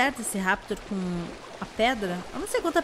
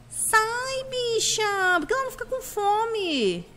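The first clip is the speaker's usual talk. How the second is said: exasperated shooing; 10 semitones higher, slower than usual. Real, not acted. Shooing the annoying dino.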